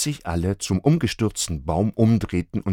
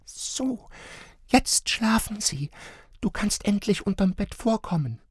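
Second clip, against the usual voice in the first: bright little voice; 13 semitones higher than usual. A narrator's reading. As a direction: whisper like a tiny bright voice. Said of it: "helles Stimmchen flüsterte".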